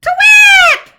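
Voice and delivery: very loud, high "twit"; shouted owl call. A narrator's reading. Olive shouting out for Albert, loud call. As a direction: shout "twit" at full force.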